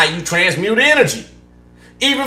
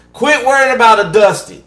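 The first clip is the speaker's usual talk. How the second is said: louder and higher, cutting it off; firm scolding; 7 semitones higher, 7 dB louder than usual. Real, not acted. Reader ordering viewers to drop him, louder and fed up. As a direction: tell them to quit it, fed up.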